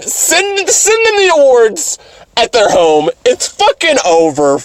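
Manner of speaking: high, hammed-up raving adults; mock-ecstatic, high-pitched raving; over-the-top hype. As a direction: gush like a wildly thrilled audience. Acts adults thrilled by the movie.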